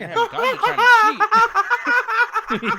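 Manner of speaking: very slow, high words through laughter; laughing. Real, not acted. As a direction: gasp it out mid laughing fit. Laughing fit.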